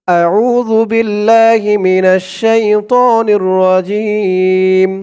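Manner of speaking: slow, drawn-out Quranic recitation. Shown as a demonstration. Teacher intoning the opening to viewers, slow recitation. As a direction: intone each word slowly and devoutly.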